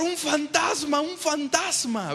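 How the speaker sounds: high panicked cry of a ghost sighting; frightened cry. Retold or imitated. Disciples in the storm crying out in high panic. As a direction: squeal it out like a scared disciple. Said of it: "empiezan a gritar".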